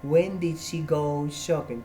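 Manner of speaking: very slow, careful question; slow model question. Shown as a demonstration. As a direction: spell it out slowly for the class. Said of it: "Entonces, ¿cuándo?".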